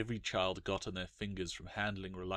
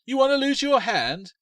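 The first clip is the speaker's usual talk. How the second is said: high, loud alarmed cry in another voice; a park custodian crying out to a girl; cried out; 9 dB louder than usual. Acted. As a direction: yell the warning at her. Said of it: "the man cried".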